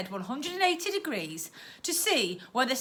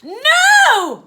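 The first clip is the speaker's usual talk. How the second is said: much higher and louder cry; protesting shout; 14 dB louder than usual. Acted. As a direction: refuse outright. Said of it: "protested the girl".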